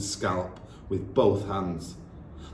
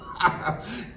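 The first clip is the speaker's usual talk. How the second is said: cruel laugh; 11 semitones higher than usual. Acted. Trunchbull laughs.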